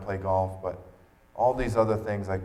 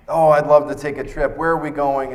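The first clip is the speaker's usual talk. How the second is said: eager delight; 6 semitones higher, 10 dB louder than usual. Retold or imitated. [name] imagines a beach trip.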